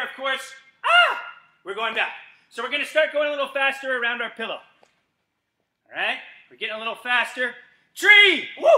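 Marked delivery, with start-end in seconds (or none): 0.8-1.2 high startled scream; playful mock fright scream; scared yelp. Shown as a demonstration. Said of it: "jump away ... and go". 7.9-8.7 high, louder call; excited game call; shouted command. Acted.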